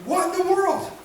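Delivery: a high, baffled cry of disbelief; bewildered sisters. Retold or imitated. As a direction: ask it like a stunned, grieving sister. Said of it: "his sisters are sitting there going".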